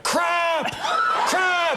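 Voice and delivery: very high, drawn-out jeer; mock heckling yell. Kid jeering as the actor leaves, high mock yell. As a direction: holler it after someone leaving, hammed-up. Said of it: "and he went".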